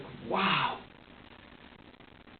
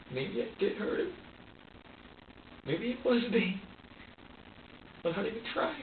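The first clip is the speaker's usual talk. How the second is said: higher, strained, near sobbing; real crying through the words; on the verge of tears; 7 semitones higher, 4 dB quieter than usual. Real, not acted. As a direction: voice cracking as the guilt hits. Breaking down over hurting the baby.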